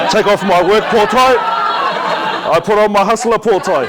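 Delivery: high, loud, laughing through the words. Real, not acted. Telling the audience about his hustler hat, laughing loudly. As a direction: say it while cracking up.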